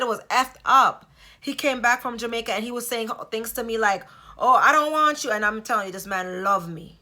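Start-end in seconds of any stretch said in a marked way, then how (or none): 4.4-5.3 higher, louder, cold "I don't want you"; cold rejection. Retold or imitated. Husband rejecting his wife after Jamaica, loud and cold. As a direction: fling it at her like a stranger. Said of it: "that didn't even sound like him".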